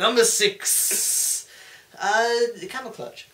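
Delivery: high, slow, stretched countdown ending in a loud shout; showman's big finish; Jericho list promo. Retold or imitated. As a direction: tease the crowd, then hit the last word hard. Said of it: "I love his promo".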